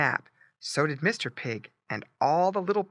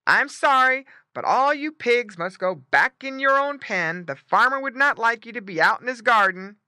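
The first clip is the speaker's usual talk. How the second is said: loud, higher firm orders; emphatic barked explanation; barking dog; 8 semitones higher, 6 dB louder than usual. A narrator's reading. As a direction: send the pigs home as guard dog. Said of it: "barked Don the dog".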